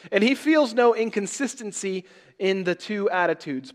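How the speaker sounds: loud, high, ringing pulpit delivery; full-force preaching. Acted. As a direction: proclaim it to the whole church. Preacher reading the Robertson quote.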